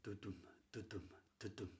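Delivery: very soft, low du-dum pulse; hushed, low iambic heartbeat beats; heartbeat imitation. Shown as a demonstration. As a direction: sound out a quiet heartbeat. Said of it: "is matching our heartbeat".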